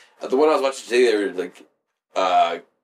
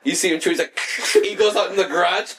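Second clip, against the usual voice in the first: ordinary talk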